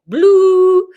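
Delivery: loud, high ringing "blooo"; imitated singing bowl. Host previewing her bowls for viewers, ringing imitation. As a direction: hold one long high note like a bowl.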